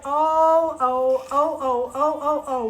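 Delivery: loud, quick thrilled ohs; excited ohs. Real, not acted. Seeing the tiny doll coat, loud excited ohs. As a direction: burst out with delight.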